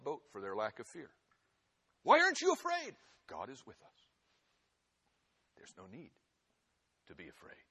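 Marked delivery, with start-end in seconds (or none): 2.0-3.0 incredulous question. Retold or imitated. Quoted question to the Moravians. 3.3-4.1 soft hushed affirmation with a rise on is. Shown as a demonstration. Preacher to congregation, soft hushed affirmation. 5.5-7.7 hushed comfort. Acted. Hushed comfort after the question.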